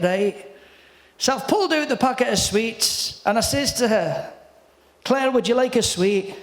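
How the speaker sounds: Scottish comedian telling an anecdote; storytelling impression of a Scottish comic; Billy Connolly. Shown as a demonstration. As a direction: spin the yarn as a Scottish stand-up. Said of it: "I'm gonna do it in your voice".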